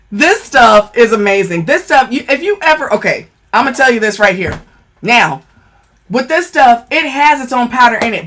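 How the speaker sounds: normal talking voice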